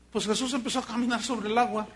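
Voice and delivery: ordinary talk, nothing marked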